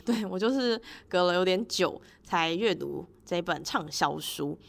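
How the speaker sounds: ordinary talk, nothing marked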